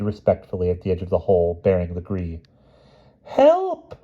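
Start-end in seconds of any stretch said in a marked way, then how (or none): 3.2-3.9 very high, loud theatrical cry; the queen hamming up her distress; witch's cry for help. Acted. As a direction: squeal for help like a fake damsel. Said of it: "cried the witch".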